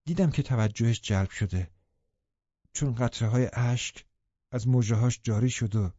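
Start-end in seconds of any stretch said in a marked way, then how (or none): none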